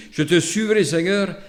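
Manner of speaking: normal talking voice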